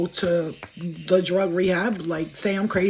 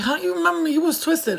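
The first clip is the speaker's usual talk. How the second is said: high-pitched incredulous question; disbelieving quote, voiced high; mock astonishment; 8 semitones higher than usual. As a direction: imitate someone amazed, pitch jumping up. Acts out amazed people's question.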